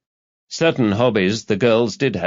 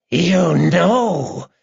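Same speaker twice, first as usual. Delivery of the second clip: high, drawn-out madman's gasp; gasped joy and terror; 9 semitones higher, 4 dB louder than usual. A narrator's reading. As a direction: gasp it high, thrilled and terrified. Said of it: "he gasped, his voice a mixture of joy and terror".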